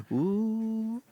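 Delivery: high, drawn-out teasing "ooh". Pretend. Guest ribbing host for texting his girlfriend, teasing ooh. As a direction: swoop up high like a schoolkid teasing.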